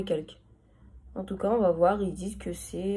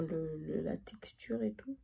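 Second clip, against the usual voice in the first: soft unvoiced whisper; 16 dB quieter than usual. Real, not acted. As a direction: whisper it to yourself. Whispers while examining the product.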